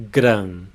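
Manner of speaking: gran said with a nasal vowel and a lightly trilled R; nasal Portuguese vowel. Shown as a demonstration. Teacher isolates the first syllable of grande for students. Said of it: "the R pronounced as a light trill".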